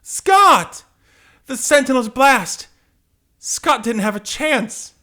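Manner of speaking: very high, anguished scream; horrified heroine. Acted. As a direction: cry out for your fallen lover. Jean sees Scott blasted.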